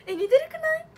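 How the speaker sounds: thrilled disbelief; excited. Real, not acted. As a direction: beg the viewers to agree. Comparing herself to the panel.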